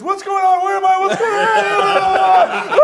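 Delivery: very high, loud frantic yell; panicked screaming. Retold or imitated. Tyler waking bewildered from his trance, panicked high yelling. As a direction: scream it, stretching the last words. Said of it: "And Tyler goes".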